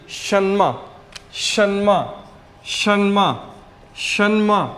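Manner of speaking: शन्मा said slowly, each syllable clear; pronunciation demo. Shown as a demonstration. Teacher modeling शन्मा for students, careful pronunciation. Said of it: "next word है S H E N M".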